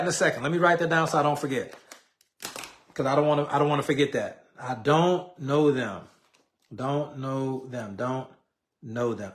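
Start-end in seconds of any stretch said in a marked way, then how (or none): 4.6-9.3 slow, low, drawn-out words; half-speed, soft and trailing lower; writing aloud. Real, not acted. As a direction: say it to yourself as you write it down. Writing the question down while speaking.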